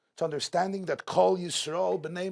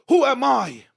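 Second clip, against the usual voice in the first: high, loud burst of self-doubt; loud incredulous cry; 9 semitones higher, 12 dB louder than usual. Acted. Preacher voicing people afraid to shine, loud incredulous cry. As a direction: cry it out as the climax.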